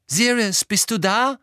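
loud, high call into an empty house. A narrator's reading. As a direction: shout to find someone. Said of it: "Seine Stimme hallte durch den Raum".

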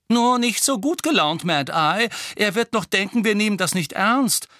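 put-on high, loud, playful young witch; teasing Tonks. A narrator's reading. Tonks mocks Moody's grim mood, high and loud. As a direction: tease him in a bright high voice.

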